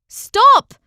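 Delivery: sharp high shouted "Stop!"; yelled stop. A narrator's reading. Una yelling at the fighting knights. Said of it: "Una yelled".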